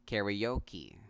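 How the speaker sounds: "Karaoke" as kair-ee-OH-kee; American pronunciation. Shown as a demonstration. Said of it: "this word is pronounced".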